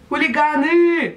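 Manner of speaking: slow disbelieving echo of Chuligani?; are you serious, Chuligani?; incredulous. Real, not acted. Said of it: "Come on!".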